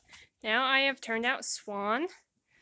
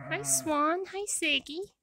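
normal talking voice

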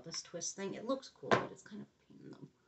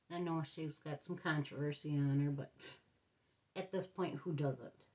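ordinary talk, nothing marked

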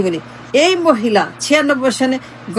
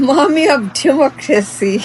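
slow, sneering put-on voice on "mommy"; mock title; much slower than usual. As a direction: say "mommy of democracy" slowly as a joke name. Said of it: "এর চাইতে funny joke".

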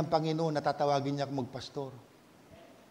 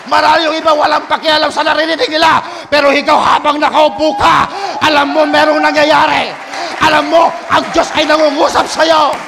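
building preacher's fervor, louder; preaching; 9 semitones higher, 14 dB louder than usual. Acted. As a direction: preach it with rising conviction. Preaching at full force.

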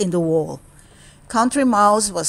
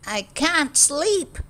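higher, slow complaint; 7 semitones higher, slower than usual. Acted. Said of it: "he said".

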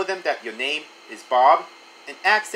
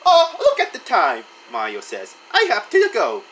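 high Mario voice; 6 semitones higher, 4 dB louder than usual. A narrator's reading.

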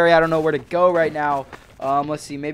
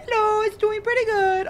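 Rosalina. Acted. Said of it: "Rosalina, how is it going?".

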